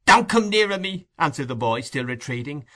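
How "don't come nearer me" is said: high-pitched warning in horror; a boy recoiling from a murderer; horrified boy. A narrator's reading. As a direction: keep him off, voice pitched up. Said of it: "looking with horror in his eyes".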